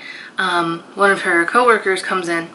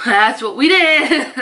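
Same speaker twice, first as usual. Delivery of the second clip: laughing; 7 semitones higher, slower than usual. Retold or imitated. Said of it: "It's like".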